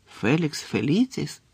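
ordinary delivery

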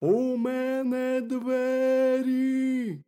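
high, drawn-out hare from underground; hollow, high wailing hare; muffled burrow voice. A narrator's reading. Hare answers from inside burrow.

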